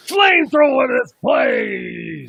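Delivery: slow, loud, high gung-ho soldier shout; Al Pacino. Retold or imitated. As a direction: bellow it slowly like a blind old colonel.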